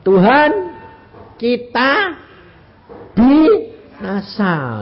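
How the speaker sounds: loud, high, drawn-out cry; stretched-out, hammed-up plea; disciples' cry. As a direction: act out the disciples crying to their Lord. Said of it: "Omongannya murid-murid bilang apa tadi?".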